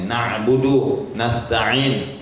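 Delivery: slow Na'budu and nasta'in with the ain from the middle of the throat; slow, careful ain in Na'budu; ain pronounced. Shown as a demonstration. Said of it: "dua-dua ainnya".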